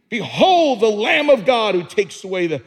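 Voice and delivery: shouted out loud, full force; screaming it. Shown as a demonstration. Preacher shouting Behold to the congregation. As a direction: cry it out like a proclamation. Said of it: "Screaming it".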